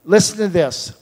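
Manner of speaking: ordinary talk, nothing marked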